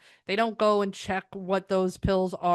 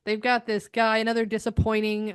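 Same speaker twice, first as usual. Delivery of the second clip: usual speaking voice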